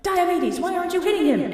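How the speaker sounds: high, exasperated goddess voice; high, exasperated mock Athena. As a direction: squeak it out, fed up. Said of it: "So Athena's like".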